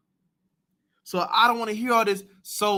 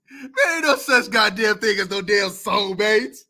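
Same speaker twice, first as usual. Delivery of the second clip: ordinary talk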